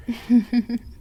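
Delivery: a brief amused laugh at the captive; villainous chuckle. Acted. Mistress laughing at her pet, a short villainous chuckle.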